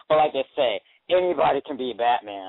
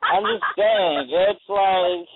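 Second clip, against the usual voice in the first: usual speaking voice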